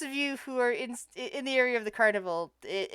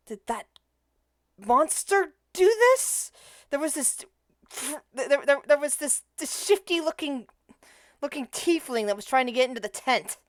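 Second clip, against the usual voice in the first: half-orc witness; 4 semitones higher than usual. Acted. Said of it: "of the tent is like".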